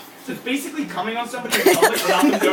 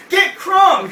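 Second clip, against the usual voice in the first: high, loud hammed-up party yell; mock girl's shout; 10 semitones higher, 8 dB louder than usual. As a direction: shout it right in someone's face like a preteen girl. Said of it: "Just like that to me".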